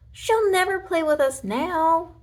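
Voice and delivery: high, slow mock wail; lightly voiced wailing dinosaur. A narrator's reading. Toy dinosaur wails that Bonnie is lost, mild high wail. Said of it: "wailed Rex".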